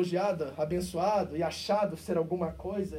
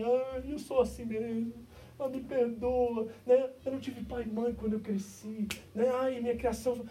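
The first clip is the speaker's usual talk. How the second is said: mock self-pity; 5 semitones higher than usual. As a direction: drag it out like a sulking victim. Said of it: "quer se jogar no chão e dizer".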